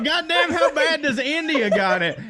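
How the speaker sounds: high, loud, laughing shock; laughing disbelief. Retold or imitated. To his friend about India, a high laughing shock. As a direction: crack up at the thought. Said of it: "I was like".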